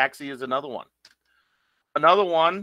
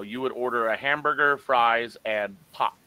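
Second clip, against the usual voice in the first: ordinary delivery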